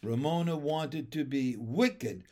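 plain reading voice

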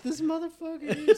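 high, loud, laughing disbelief; laughing at the drunk friend, high and loud; laughing exasperation. Retold or imitated.

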